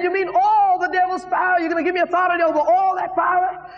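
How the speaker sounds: very high, incredulous cry; excited screaming. Retold or imitated. Young believer asking God about authority, screaming with excitement. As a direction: exclaim it in high-pitched amazement. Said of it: "I was screaming by then".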